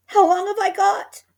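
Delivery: very high, very loud terror; panicked old wife; high loud terrified cry; frightened cry. A narrator's reading. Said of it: "cried Missus Twit".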